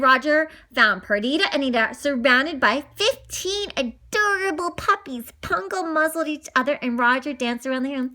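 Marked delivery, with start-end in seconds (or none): none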